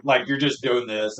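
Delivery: ordinary talk, nothing marked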